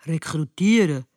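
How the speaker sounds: low grumbled question; grumbling. A narrator's reading. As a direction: grumble the word back, pitched low. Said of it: "brummte Tom".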